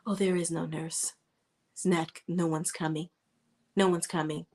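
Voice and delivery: lightly voiced cruel taunt; quoted movie line.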